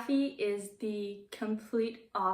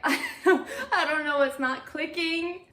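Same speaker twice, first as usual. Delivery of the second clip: laughing; 7 semitones higher, 5 dB louder than usual. Real, not acted. Laughing at the character's confusion.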